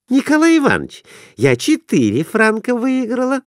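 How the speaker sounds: put-on high, girlish voice; eager wife asking to place a bet; young wife's voice. A narrator's reading. Wife calling to husband.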